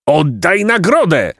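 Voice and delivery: high, commanding roar of a count; angry count roaring an order. A narrator's reading. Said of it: "ryknął hrabia".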